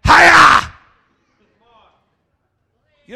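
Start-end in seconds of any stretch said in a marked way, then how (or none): 0.0-0.7 loud, high "Hiya!" yell; karate battle cry. Acted. Preacher yelling a karate cry at trouble. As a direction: shout it like a martial arts strike.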